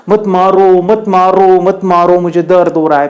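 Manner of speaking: high, loud repeated plea; pleading stone. Acted. Stone's voice reaches the sculptor outside the temple, loud pleading. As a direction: cry out as the hurting stone, easing at the end. Stone cries out again in story.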